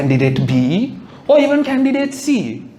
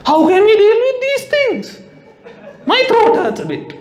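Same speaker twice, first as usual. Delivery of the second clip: high, strained dramatic voice; pushy friend gets dramatic, high and strained; overwrought friend. Retold or imitated. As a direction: whine it way up like a martyr. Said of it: "she's like".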